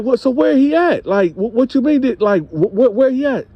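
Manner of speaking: husband demanding to know where the man went, stunned; stunned disbelief. Retold or imitated. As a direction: sputter in shock.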